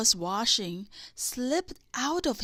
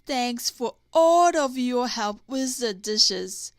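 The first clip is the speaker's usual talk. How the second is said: with a laugh; 8 dB louder than usual. A narrator's reading. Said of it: "he said with a laugh".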